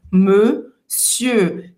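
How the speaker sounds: monsieur said very slowly, modern clipped form; monsieur, slow and deliberate, as French speak it today; slow model word. Shown as a demonstration. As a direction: stretch out monsieur, the everyday spoken way. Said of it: "Presta atenção nessa pronúncia".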